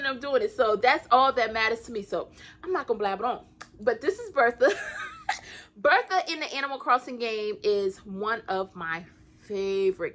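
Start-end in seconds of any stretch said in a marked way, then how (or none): 4.8-5.5 shrill squeal of a laugh; high laugh. Real, not acted. Showing off Bertha to viewers, squealing laugh. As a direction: burst into a high giggle.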